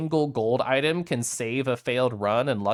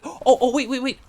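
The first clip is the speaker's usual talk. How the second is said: high, sudden excited gasp; 10 semitones higher, 4 dB louder than usual. Real, not acted. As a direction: catch yourself, thrilled. Spots shrine mid-game.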